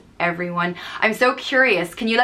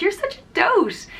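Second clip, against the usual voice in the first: teasing coo; 13 semitones higher than usual. Retold or imitated. Teasing friend about boyfriend.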